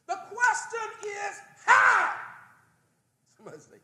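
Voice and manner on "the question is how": higher, louder fired-up cry; screaming. Real, not acted. Preacher fired up before the congregation, shouting. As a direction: let it burst out. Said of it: "I'm screaming".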